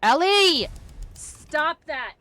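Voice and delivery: sharp loud high shout; panicking gamer. Real, not acted. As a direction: yell at the pet to stop. Yelling at cat mid-game.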